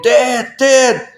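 high-pitched panicked call for Dad, imitated; choking child's cry. Son waking his dad while choking on a pill, imitated high panicked cry. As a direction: mimic a kid urgently yelling, voice pushed high.

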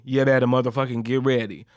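normal talking voice

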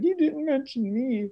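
mock a friend left off the list; offended friend. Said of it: "you're like".

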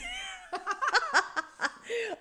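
high, loud burst of laughter; real laughter at the pot smell; big laugh. Real, not acted. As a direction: laugh out, tickled. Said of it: "I just laughed".

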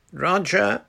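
called out high across the wood; a girl calling her brother through the trees. A narrator's reading. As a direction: call down to find the boy. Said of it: "called Susan".

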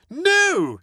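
high, loud, firm refusal; Motty refuses Bertie's excuse, high and loud. Acted. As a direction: shut it down firmly. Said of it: "he replied firmly".

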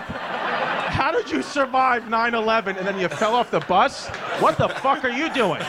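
high, loud disbelieving rant; exasperated yell at mom; incredulous outburst. Retold or imitated.

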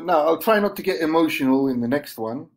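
ordinary talk

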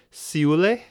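Seoule as "see-oo-leh", high and loud; completely wrong pronunciation. Pretend. Trying an absurd name on listeners, Seoule said as "see-oo-lay". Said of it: "pronounce it completely wrong".

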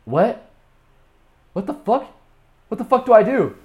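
high, disbelieving outbursts with pauses; mock panic. Man abroad with no idea what to do, acted bewildered panic. Acts out his helpless panic.

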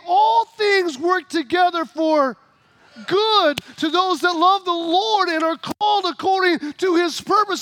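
full-force pulpit quote; preaching scripture. Acted. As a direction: proclaim it slow to the congregation.

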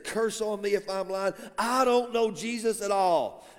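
normal talking voice

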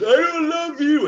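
put-on high, loud, stretched-out kid cry; hammed-up bratty child; upset child. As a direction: wail it slowly as a small child. Child rejecting teacher, acted again.

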